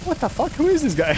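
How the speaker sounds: high-pitched mock disbelief; utterly confused, played for laughs; retold bewilderment. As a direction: imitate a baffled man, voice shooting up. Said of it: "he was so confused".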